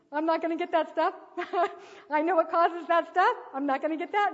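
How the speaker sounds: loud, high mock-baby declaration; voicing defiant baby. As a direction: call it out high and loud, as the child. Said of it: "she just looked at Mom, like".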